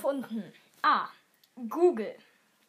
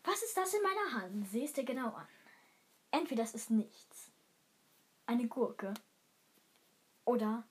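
hushed; 4 semitones lower, 6 dB quieter than usual. Acted. Mysterious final question.

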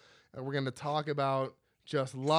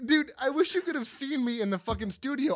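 ordinary delivery

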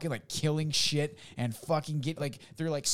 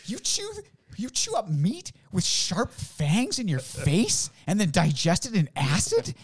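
horrified alien; 9 semitones higher, 6 dB louder, slower than usual. Retold or imitated. Alien horrified by human eating.

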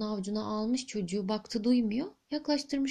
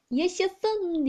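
put-on higher, cheering shout of the boy; mock shout of joy, storybook style; joyful cry; 7 semitones higher than usual. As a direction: cry out in delight. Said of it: "diye bağırıvermiş".

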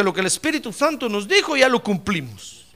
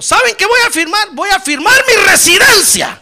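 loud, high, triumphant defiance; defiant declaration; 12 semitones higher, 12 dB louder than usual. Retold or imitated. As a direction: proclaim it boldly to the officers. Woman defiantly declares to the officers. (desafiante, a voz en cuello)

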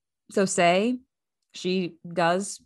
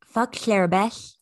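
Sid the Sloth voice; 2 semitones higher than usual. Pretend. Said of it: "and I said that shit".

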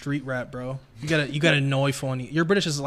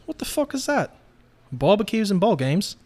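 put-on higher-pitched British-accented scoff; 6 semitones higher than usual. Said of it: "in a British accent".

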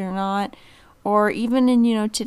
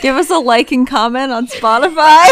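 laughing; 4 semitones higher, 12 dB louder than usual. Pretend. Laughing mock outro plea.

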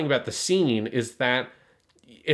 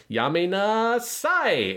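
much higher, slow, lilting Japanese scold; imitating Suletta's line read; 10 semitones higher, much slower than usual. Voicing Suletta telling the attacker to stop, high and slow. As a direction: drag it out sweetly, like a light scolding. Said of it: "Ishinose Kana's line read where she goes".

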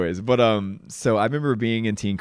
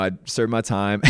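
ordinary talk, nothing marked

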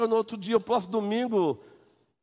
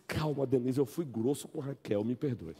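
soft apology; 5 semitones lower, 8 dB quieter than usual. Acted. Offender apologizes to angry Denise.

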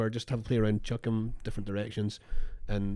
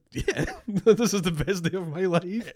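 laughing delight; 7 semitones higher, slower than usual. Retold or imitated. Retelling her delighted reply.

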